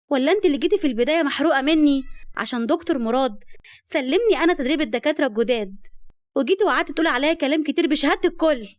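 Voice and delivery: high, loud indignant accusation; shocked outrage. A narrator's reading. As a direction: throw the lie back at her, appalled. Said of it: "شهد بصدمة من كلامها وقالت".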